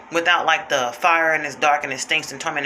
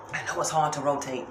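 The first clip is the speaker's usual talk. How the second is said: soft aside; 4 semitones lower, 8 dB quieter than usual. Shown as a demonstration. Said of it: "It is hard to rotate".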